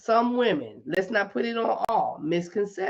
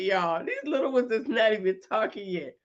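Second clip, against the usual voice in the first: usual speaking voice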